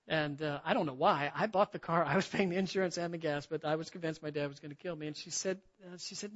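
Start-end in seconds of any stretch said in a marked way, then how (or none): none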